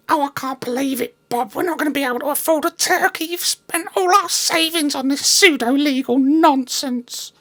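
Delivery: high, fast falsetto wife; distraught wife. Acted. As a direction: wail it in a shrill falsetto. Bob's wife despairs.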